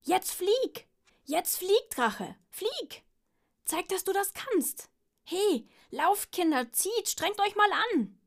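high, loud, excited urging; shouted command. Acted. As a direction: call out at full voice, ordering everyone to get moving. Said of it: "auf den Drachen und rief".

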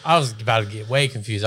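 usual speaking voice

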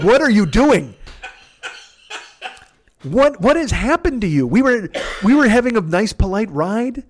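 high-pitched comic disbelief; plead, appalled, with a friend who ruins everything; exasperated protest. Acted. Acted appalled protest at his friend.

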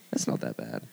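low, soft, offhand mock excuse; imitated dismissive shrug. Imitating people defending an old cartoon, dismissive shrug. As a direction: drop down low and quiet, imitating someone brushing it off.

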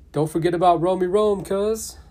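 high, loud, cocky Roman bragging for his buddy; playful Roman voice. Acted. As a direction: pitch it up and show off like Roman. Roman's line, read in voice.

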